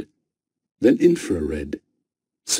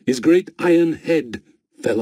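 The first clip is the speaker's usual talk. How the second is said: raised higher, pushed louder; emphatic, louder; 6 semitones higher, 3 dB louder than usual. A narrator's reading. Narrator telling the head crashing off, emphatic and louder. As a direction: lean hard into the fall.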